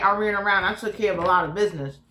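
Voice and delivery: ordinary talk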